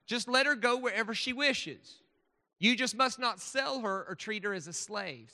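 loud Southern preacher's reading; Southern accent. Acted. Speaker reads Deuteronomy to the audience, in a loud Southern accent. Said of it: "read this is in a Southern accent".

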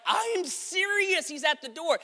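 high, drawn-out protest; earnest insisting. Acted. Servant girl to disbelieving friends, insisting.

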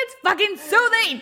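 high, loud comic comeback; mock indignant yell. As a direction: yell it as an outraged defence. Listener acts her yelled comeback.

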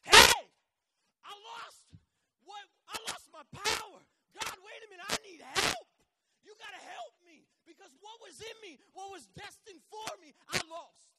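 high, loud, frantic shout to God; desperate crying out. Acted. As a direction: holler for help in full earnest. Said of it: "So he cried out".

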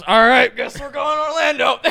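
loud, high retold exclamation; hyped agreement. Retelling Tony agreeing to go to Orlando, hyped agreement. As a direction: call it out like rallying for a trip.

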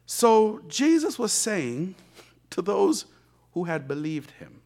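usual speaking voice

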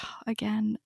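whispered, deflated aside; a stunned whispered oh, again; weary whisper. Retold or imitated. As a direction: breathe it out in dismay. Inner reaction to second congratulations.

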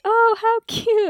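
high, slow fake gushing; phony polite admiration. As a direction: coo with put-on delight. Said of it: "it's very fake".

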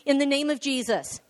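bold, slow, firm proclamation; emphatic declaration prayer. Acted. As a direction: declare each phrase for the church to repeat. Leads declaration prayer aloud.

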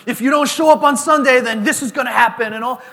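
loud, high, hard-line pastor threat; coming on strong. Pretend. Imagined hard-line pastor warning his people, coming on strong. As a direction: lay down the law at full volume. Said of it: "What if I just came out strong".

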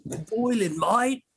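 slow, high Aussie bloke voice; Australian accent. Acted.

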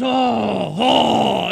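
loud hammed-up French "Oh, oh"; French accent exclamation; mock French accent. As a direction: play a scoffing Frenchman, loud. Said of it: "pops up and he goes".

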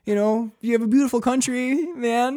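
high, meek explaining; nervous appeasing. Acted. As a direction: plead harmlessly with the cop.

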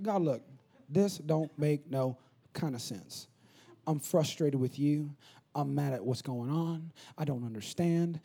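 low, soft, halting complaint to God; hushed, weary frustration; frustrated prayer. Retold or imitated. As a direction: grumble quietly in slow, heavy words.

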